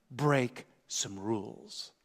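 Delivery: soft, very slow, drawn out; hushed slow emphasis. Shown as a demonstration. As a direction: let it sink in, almost under your breath.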